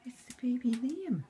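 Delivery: high excited pet voice; talking to a dog. Real, not acted. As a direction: hype it up for the dog.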